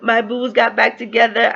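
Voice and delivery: high drawn-out "oh", then gushing; emotional, happy gushing. Retold or imitated. Reader reliving her favorite couple's reunion, emotional gushing. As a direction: coo over a couple's reunion, pitched high. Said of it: "It was so emotional".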